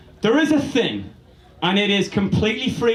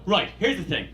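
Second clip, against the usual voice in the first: normal talking voice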